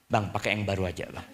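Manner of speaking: soft, low soothing aside; calm him down, voice dropped low and quiet; placating wife. Acted. Wife offering angry husband new socks, soft and soothing.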